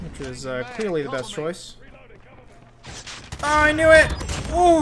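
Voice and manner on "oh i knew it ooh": excited outburst. Real, not acted. Outburst in a firefight.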